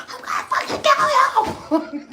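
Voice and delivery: high, slow laughing mock yell; mock rage scream. Mother chasing daughter upstairs, laughing mock yell. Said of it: "I was like".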